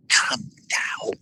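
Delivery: silent, mouthed come down; mouthed call. Retold or imitated. Fan mouths up at George in the window, whispered. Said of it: "I made a motion like".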